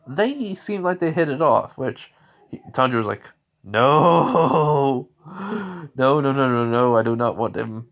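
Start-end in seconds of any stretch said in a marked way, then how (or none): 3.6-7.9 mock-panicked objection. Tanjiro objecting, overprotective brother.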